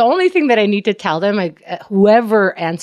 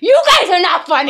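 mock indignant retort; 11 semitones higher, 4 dB louder than usual. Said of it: "I'm like".

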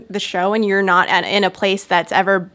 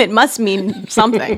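ordinary talk

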